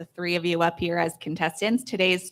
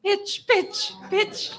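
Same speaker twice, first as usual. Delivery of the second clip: high-pitched playful 'Pitch, pitch' chant; high mock pitch call; 12 semitones higher, much slower than usual. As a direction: call 'pitch' like a kid at bat.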